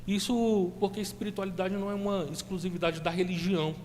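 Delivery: loud, impassioned sermon delivery; preaching. Acted. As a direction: preach it out with fervor. Preaching builds to full force.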